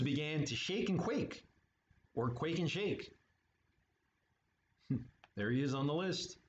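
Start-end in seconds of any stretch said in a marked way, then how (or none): none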